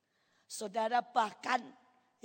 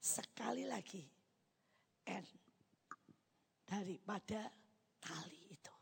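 very soft, half-whispered acceptance; hushed reply to God; 2 semitones lower, 10 dB quieter than usual. Retold or imitated. Believer accepting God's test, hushed and halting. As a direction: answer under the breath, haltingly. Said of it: "Saya bilang".

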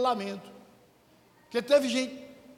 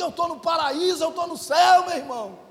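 elated; 3 semitones higher, 6 dB louder than usual. Retold or imitated. Retold elated answer to friends.

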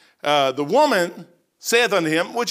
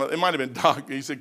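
ordinary talk

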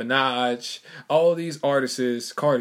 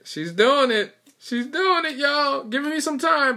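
excited anticipation; 4 dB louder than usual. Real, not acted. Waiting for partner to sneeze.

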